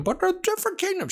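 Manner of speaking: very high, rapid squeaky mimicry; mocking imagined objector. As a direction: mimic a nitpicking audience in falsetto. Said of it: "everyone's probably thinking".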